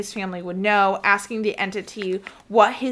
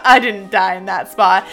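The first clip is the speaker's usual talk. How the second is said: louder, laughing prankster ghost; mock taunt through giggles; teasing witch; 7 dB louder than usual. Witch pranking the searchers at the hill, laughing tease. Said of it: "she'd be like".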